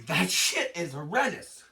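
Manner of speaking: ordinary talk, nothing marked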